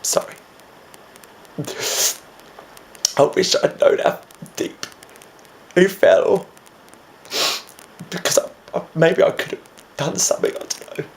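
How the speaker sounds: choked, halting words breaking high; struggling to speak while crying; tearful grief. Acted. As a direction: mourn a lost friend through tears. Grieving a friend's suicide.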